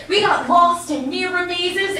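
ordinary talk, nothing marked

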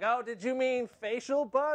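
high-pitched, know-it-all correction; mock a search engine on its high horse; haughty Google. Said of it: "Google on its high horse was like".

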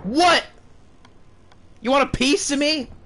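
high loud startled shout. Real, not acted. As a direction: yell back in alarm. Jump scare, defiant call.

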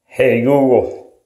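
normal talking voice

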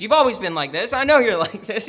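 loud exasperated complaint; a fed-up prophet accusing God; hammed-up accusation, a laugh breaking through. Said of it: "he says".

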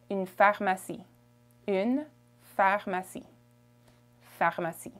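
"pharmacie" smoothly in French; French pronunciation. Shown as a demonstration. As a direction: model "pharmacie" with French R and vowels. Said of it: "Pharmacy in French".

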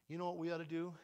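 usual speaking voice

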